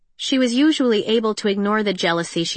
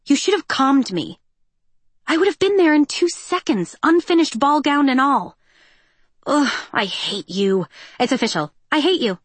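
playful exasperation; 6 semitones higher, 3 dB louder than usual. Acted. Peony's playful fury.

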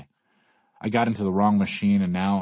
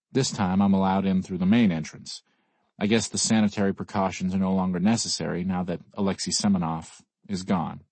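plain reading voice

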